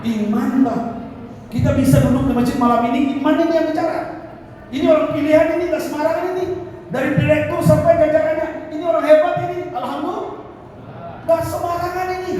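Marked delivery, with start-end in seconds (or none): 1.5-12.4 high, emphatic praise from the pulpit; impassioned preaching. Real, not acted. Preacher praises worshippers gathered tonight at the mosque, impassioned preaching. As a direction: lift the congregation with high, ringing phrases.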